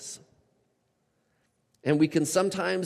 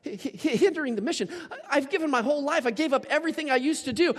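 stunned protest; 11 semitones higher than usual. Retold or imitated.

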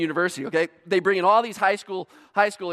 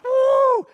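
loud high "woo" of a flying leap; mock stunt whoop; 7 dB louder than usual. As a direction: whoop as if launching over a car. Acts out athlete's leap.

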